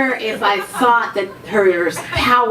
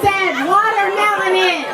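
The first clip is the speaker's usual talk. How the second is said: triumphant shout; 7 semitones higher than usual. Real, not acted. Triumphant about the racist punchline.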